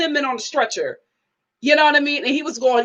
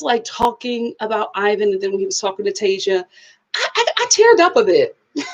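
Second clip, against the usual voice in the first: ordinary delivery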